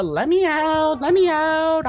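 high, loud panicked plea; scared wail. Real, not acted. Streamer begging to be let out of the room, scared wail. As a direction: wail to be released. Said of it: "I'm scared".

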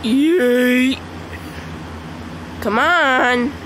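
ordinary delivery

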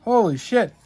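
normal talking voice

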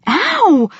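sharp, high-pitched "ow"; sudden pained yelp. A narrator's reading. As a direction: cry out high as if just bitten. Narrator is bitten on the leg.

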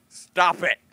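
playful loud snap; mock scold. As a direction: tell your friend off in jest. Scolding imagined friend Andrew.

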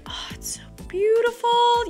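ordinary talk

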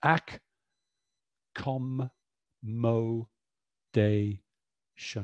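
"ac, com, mo, day, shun" each chunked apart; syllable by syllable. Shown as a demonstration. Teacher chunking "accommodation" for parents. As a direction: pause between each syllable. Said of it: "that's where the split will go".

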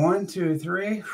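ordinary talk, nothing marked

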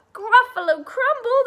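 high, loud acted shriek; horrified Gruffalo. Gruffalo hears the mouse's threat, shrieking in acted horror.

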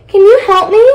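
high little plea; small high voice, rising into a question. A narrator's reading. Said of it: "said the little voice".

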